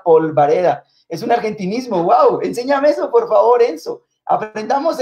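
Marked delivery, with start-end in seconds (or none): 2.0-4.0 high, eager plea to be taught; rising excitement, asking to learn; delighted high-pitched curiosity; excited wow. Real, not acted. Excited surprise at new word.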